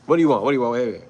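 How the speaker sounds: imitating a loud, brusque street preacher; mock loud, rapid-fire challenge; loud, curt challenge. Camp leader confronting a visitor who approached, loud and brusque. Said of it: "He's the loudest one".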